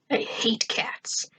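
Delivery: hushed, slow hiss of dislike; whispered contempt for cats; whispered grudge. A narrator's reading. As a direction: say it under your breath. Narrator's muttered cat grudge.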